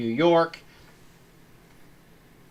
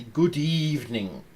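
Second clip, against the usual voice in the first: terrible Bela Lugosi Dracula. Retold or imitated. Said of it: "I'm doing a terrible accent".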